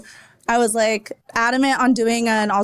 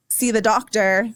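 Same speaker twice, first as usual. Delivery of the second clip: ordinary delivery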